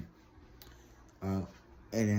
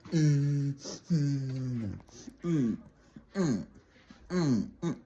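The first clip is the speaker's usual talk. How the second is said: imitated niece grunts; 4 semitones higher, much slower than usual. Niece fighting back, imitated defiant grunts. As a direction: grunt mm over and over, imitating a feisty kid. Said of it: "my niece would be like".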